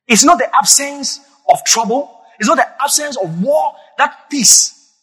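ordinary delivery

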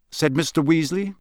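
usual speaking voice

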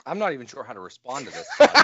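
amused, can't believe it; laughing disbelief. Real, not acted. Laughing disbelief at odd answer.

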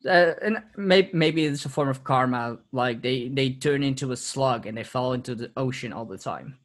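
normal talking voice

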